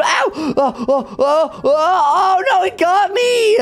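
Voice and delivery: panicked screaming; scared horror game streamer. Real, not acted. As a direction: yell in terror as it grabs you. Caught by monster in game.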